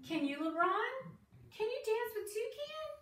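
very high, singsong question; playful high questioning. Real, not acted. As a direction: coax a little one with a squeaky rising question.

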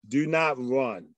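ordinary talk, nothing marked

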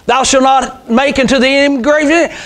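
usual speaking voice

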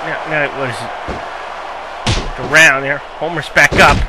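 wrestling commentator. Acted. Live wrestling match commentary.

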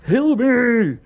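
loud, high yell for help; shouting. Real, not acted. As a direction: yell for a heal. Demanding healing mid-fight.

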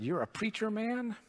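sudden high excited question; light up with delight at meeting a preacher; lit up. Retold or imitated. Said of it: "the old man lit up and said".

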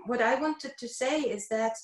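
usual speaking voice

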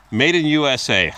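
mocking announcement of a label; loud scoffing. Real, not acted. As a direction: read it out loud and high, scoffing. Scoffs at the label, then laughs.

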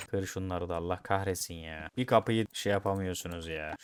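normal talking voice

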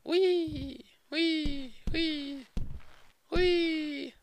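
very high, small squeals; tiny high whees. Pretend. Player losing legs in game, tiny falsetto whees.

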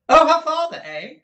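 very high, blaring repeat; undepressed, loud voice. A narrator's reading. Miss Nagg repeats the answer for all to hear, loudly. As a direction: blurt it out at full volume, high. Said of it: "without the slightest depression of her voice".

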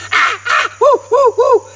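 high monkey hoots and shrieks; screeched "ah ah", then high whoops. Retold or imitated. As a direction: let loose like an excited monkey. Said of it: "that monkey cut loose".

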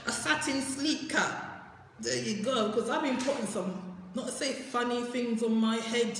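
normal talking voice

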